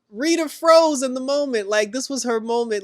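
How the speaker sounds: "froze" hit high and loud; exasperated exclaim. Real, not acted. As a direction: blurt it out in disbelief. Exclaims over Rita freezing.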